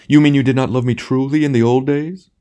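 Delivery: high, loud theatrical question; affronted tragedian. A narrator's reading. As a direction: ham actor wounded in love.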